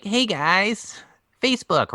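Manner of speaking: high-pitched guessed-at celebrity impression; Mark Zuckerberg. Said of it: "I don't know what he sounds like".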